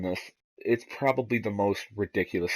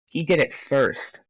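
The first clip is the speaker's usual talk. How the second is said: high, drawn-out mock-kid protest; 6 semitones higher, 4 dB louder, slower than usual. Kid answering the principal's scolding, protesting. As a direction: blame the other boy, pitched up and slow.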